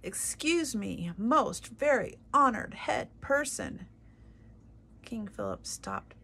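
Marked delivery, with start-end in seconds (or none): 0.0-3.9 small mouse straining to be heard, louder and higher; as loud as he can. Acted. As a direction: call up with all your little might. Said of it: "He spoke as loudly as he was capable of speaking".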